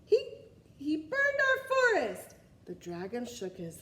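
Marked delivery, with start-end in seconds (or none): 0.0-2.3 high, loud, slow blaming shout; accusing king. Acted. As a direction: point and blame like a child-king. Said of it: "the king pointed to the dragon".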